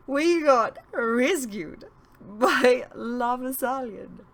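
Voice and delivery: high, slow giddy giggling; tipsy laughing relief, drawn out. Acted. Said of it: "Lolitha had started giggling".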